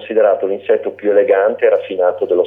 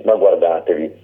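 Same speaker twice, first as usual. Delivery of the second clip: plain reading voice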